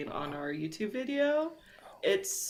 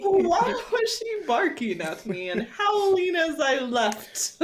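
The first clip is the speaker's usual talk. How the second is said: laughing; 10 semitones higher, 6 dB louder, slower than usual. Real, not acted. Laughing while mock-reading.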